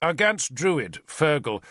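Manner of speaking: ordinary delivery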